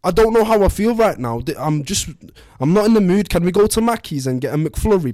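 high, loud, upset put-on Scouse voice; distraught wife. Wife begs husband for help, high distraught voice. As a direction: play the wounded wife, pitched high and loud.

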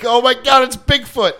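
hammed-up hunter who thinks he found Bigfoot; Bigfoot hunter thrill. Mocking a TV Bigfoot hunter hearing a dying moose, hammed-up squeal. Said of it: "and they're like".

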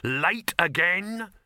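high, loud, drawn-out query; exasperated boss. Acted. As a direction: stretch it into a pointed accusation. Boss demands absent actress.

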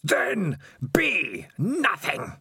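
high, very slow roaring command; drawn-out roar. A narrator's reading. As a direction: stretch each word out into a huge wail. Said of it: "came in a roar".

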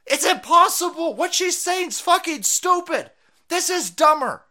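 high, loud exasperated rant; outraged. Real, not acted. Host rejects what the politician is saying, outraged. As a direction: hammer it out in disbelief.

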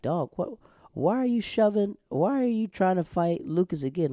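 high-pitched, disbelieving questioning; mock exasperated. Podcaster asking Nathan why he fights Lucas, mock exasperated. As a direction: take him to task in playful disbelief. Scolds Nathan as if to his face.

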